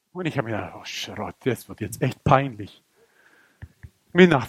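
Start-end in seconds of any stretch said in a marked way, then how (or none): 0.7-2.8 low, soft inner voice; embarrassed aside. Acted. Dreamer dreading the arm-wrestling match, low embarrassed aside. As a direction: mumble your dread to yourself.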